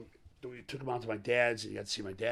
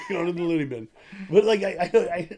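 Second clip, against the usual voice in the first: laughing through the words, louder; 9 semitones higher, 14 dB louder than usual. Real, not acted. Laughing as he teases his friend about the loony bin. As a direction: giggle it out while teasing.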